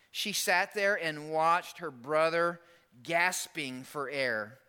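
ordinary talk, nothing marked

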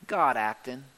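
low, understated payoff; weighty answer. Real, not acted. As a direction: drop low on "God acting".

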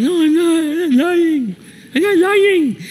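very high mock-kid whine; high-pitched childish protest. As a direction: imitate a boy squealing his denial. Accused child protests to father.

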